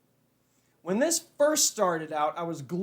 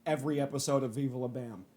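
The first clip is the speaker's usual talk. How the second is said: calmed down; 6 semitones lower, 7 dB quieter than usual. Real, not acted. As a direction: lower and much softer, mellow after the break. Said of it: "calm down and relax".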